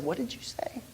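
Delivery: put-on gentle whispered question to a child. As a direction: ask it under your breath, softly. Father whispers back to daughter.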